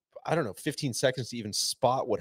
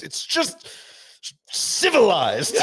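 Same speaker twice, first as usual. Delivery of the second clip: put-on a higher, drawn-out British accent, civilized rising at the end; bad British accent; 10 semitones higher, 6 dB louder, slower than usual. Unnamed British fan explaining the event to his wife, in a bad British accent. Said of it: "I apologize in advance for a bad British, uh, accent".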